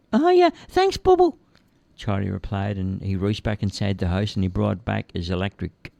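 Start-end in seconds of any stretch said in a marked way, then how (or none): 0.1-1.4 put-on high, childlike simple lad voice; cheerful high-pitched lad; Charlie's voice. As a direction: play the big innocent lug, high and eager. Charlie remembering.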